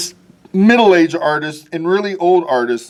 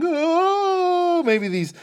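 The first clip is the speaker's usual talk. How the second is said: wailing; 5 dB quieter, much slower than usual. Acted. Wailing cartoon face demo.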